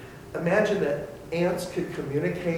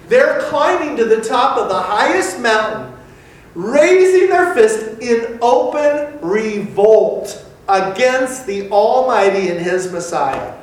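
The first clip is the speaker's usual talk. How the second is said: loud and higher, slow on the fist; full-force preaching; 7 semitones higher, 14 dB louder than usual. Acted. Preacher describing humanity's revolt, loud and rising. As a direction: thunder it from the pulpit.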